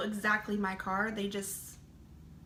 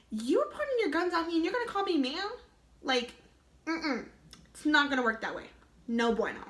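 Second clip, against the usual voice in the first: higher, indignant inner voice mocking the polite ma'am; sassy inner retort; 5 semitones higher than usual. Girl stopped by police mocks the cop's polite ma'am, indignant and higher. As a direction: scoff at him in your head, higher.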